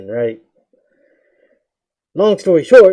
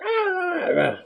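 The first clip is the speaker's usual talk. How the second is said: rage yells; 4 dB quieter, slower than usual. Retold or imitated.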